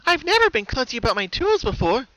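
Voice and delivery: high, bright-toned cheerful pony voice; high cheerful cartoon pony; Pinkie Pie voice. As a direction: play the sweet pony with a sinister calm.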